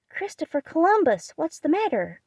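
high, loud exclamation of alarm; startled cry. A narrator's reading. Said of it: "cried Jo".

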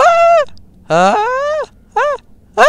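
short loud high "ah" bursts; repeated high test shouts; high yelps. Real, not acted. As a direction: yelp high into the mic to be picked up. Testing whether game hears him.

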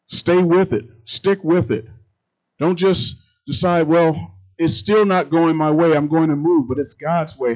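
loud, ringing sermon delivery; booming pulpit voice; full-force preaching. Acted. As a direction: preach it out to the congregation.

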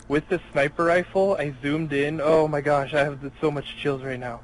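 ordinary talk

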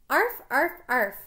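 high, loud "arf" barks; spoken dog yips, punched out. Acted. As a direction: bark like an excited dog in a story. A dog character barking.

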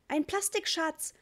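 put-on high, slow, delighted child's call; small boy showing off his find, thrilled; excited little boy. A narrator's reading. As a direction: announce the treasure with wonder. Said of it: "schrie Ritchie begeistert".

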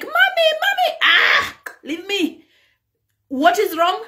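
put-on high child voice calling mommy; kids calling mommy. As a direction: squeal it like small kids. Kids greeting angry parent.

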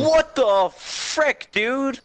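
high, drawn-out what the frick; shocked outcry. Real, not acted. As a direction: yelp it in disbelief. Boss hits him unexpectedly.

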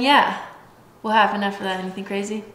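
ordinary talk

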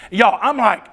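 ordinary talk, nothing marked

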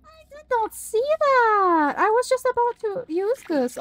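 loud, high, slow outburst; outraged shout. Real, not acted. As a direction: yell in shocked disbelief. Caught off guard by a monster.

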